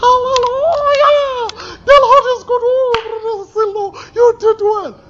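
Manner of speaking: high, squealing overjoyed wife; mock ecstatic wife praising; excited wife. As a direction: gush like a thrilled wife shouting hallelujah. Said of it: "she will be like".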